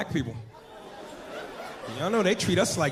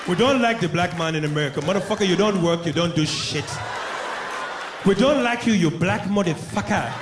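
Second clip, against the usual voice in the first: a hint of an African accent, scornful. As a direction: sneer at the American visitor.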